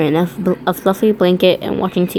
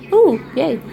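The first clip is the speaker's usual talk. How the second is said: high gleeful ooh yay; excited squeal; 9 semitones higher than usual. Real, not acted. Reader cheering the cuddle, high excited squeal.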